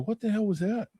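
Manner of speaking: a touch of alarmed roommate; startled bunkie. Retold or imitated.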